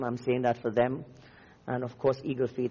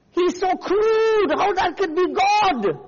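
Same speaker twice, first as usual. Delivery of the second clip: very high, loud, drawn-out mock outrage; outraged critics; 7 dB louder, slower than usual. Preacher voicing offended people against the prophet, mock outraged shouting. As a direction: cry out as a scandalized objector, at full pitch. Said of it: "the people with the wrong Jesus were saying".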